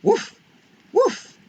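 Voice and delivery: high, loud yappy puppy woofs; puppy bark. Acted. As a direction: bark like a small eager puppy. Puppy barks.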